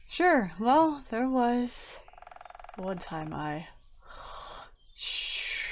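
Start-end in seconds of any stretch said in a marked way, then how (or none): 2.8-5.6 fading into a soft snore; trailing off to snore. Acted. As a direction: drift off into sleep. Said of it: "trails off as he begins to hear soft snoring".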